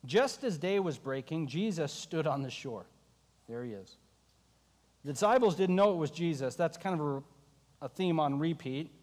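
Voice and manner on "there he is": hushed aside. Real, not acted. Pointing out Jesus softly.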